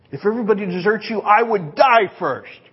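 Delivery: hammed-up vow of loyalty; impassioned Peter. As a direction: Peter swearing loyalty in earnest. Peter's boast to Jesus.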